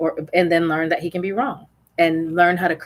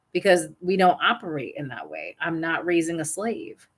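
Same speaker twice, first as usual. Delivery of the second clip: usual speaking voice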